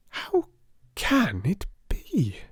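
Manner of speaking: breathy, wondering whisper to herself; puzzled whisper. A narrator's reading. Sarah puzzling over the card, whispered. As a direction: hush it out, baffled.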